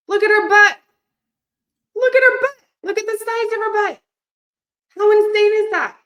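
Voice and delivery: high, loud squeals of delight; thrilled squealing at a client's transformation; excited gushing. Real, not acted. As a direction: gush over the photo like a fan. Gushing over client's photo.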